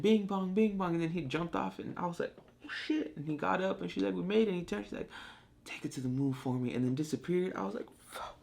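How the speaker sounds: high, choked-up retelling; getting emotional. Real, not acted. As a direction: voice climbing as the feeling wells up. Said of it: "I'm getting emotional just thinking about it".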